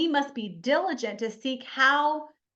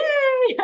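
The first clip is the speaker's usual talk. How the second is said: high, loud laugh at the idea; burst of laughter; 12 semitones higher, 8 dB louder than usual. Real, not acted. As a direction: laugh it off loudly. Mocking the picture-perfect life.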